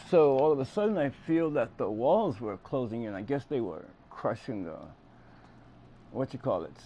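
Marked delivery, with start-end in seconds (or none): none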